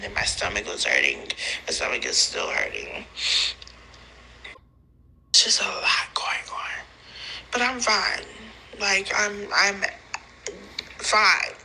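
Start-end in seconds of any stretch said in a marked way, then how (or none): 5.3-6.8 whispering. Real, not acted. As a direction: whisper it to yourself. Whispering aside.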